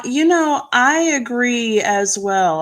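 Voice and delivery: ordinary talk, nothing marked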